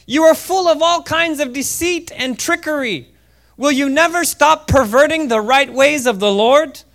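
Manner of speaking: high-pitched, loud dramatic denunciation; fiery rebuke. A narrator's reading. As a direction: read it as Paul confronting a sorcerer. Paul's rebuke read aloud dramatically.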